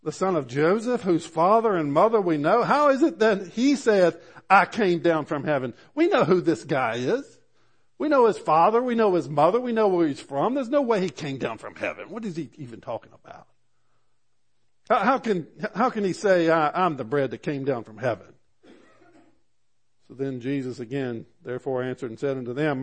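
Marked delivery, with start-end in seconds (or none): 0.0-5.7 higher, louder mocking disbelief; incredulous scoffing, pitched up and loud; scoffing crowd. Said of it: "because they said". 5.9-18.3 high, loud indignant scoffing; mock the claim, outraged; loud incredulous crowd voice; scornful disbelief.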